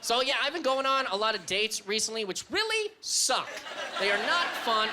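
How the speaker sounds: normal talking voice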